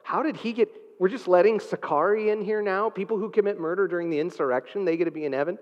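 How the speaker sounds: an angel sputtering in disbelief; indignant angel. Pretend. Angel objecting to a murderer in heaven, mock indignation. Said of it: "maybe an angel is like".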